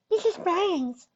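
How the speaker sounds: put-on very high little-girl voice; little filly. A narrator's reading. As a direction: speak high and small, introducing a friend.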